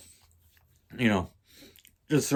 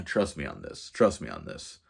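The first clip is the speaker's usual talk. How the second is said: lower, softer retold reassurance; low assurance; 8 semitones lower, 6 dB quieter than usual. Friend selling his scheme to doubtful roommate, low assuring voice. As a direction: drop the voice to sound confidential.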